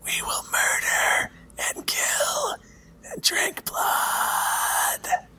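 high, slow, breathy whisper; eerie sword whisper. Acted. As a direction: whisper it like a cursed blade.

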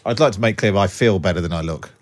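normal talking voice

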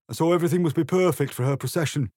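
a nervous card hurrying the words out; three of hearts. A narrator's reading. Three of hearts frets to Alice about the Queen, high and fast. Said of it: "the three of hearts added".

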